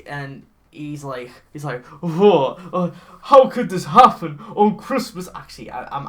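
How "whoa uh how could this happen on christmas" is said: louder, hammed-up Santa lament; mock a bewildered Santa stranded; imitating film Santa. Podcaster mimicking the film's stranded Santa, hammed-up and loud. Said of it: "I'm actually doing this, like, too good".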